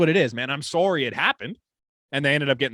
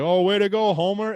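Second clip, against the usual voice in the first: high, mocking yell at the ref; a hint of a furious parent jeering; mock screaming dad; 8 semitones higher than usual. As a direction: imitate an angry hockey dad heckling. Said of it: "he is screaming at this ref".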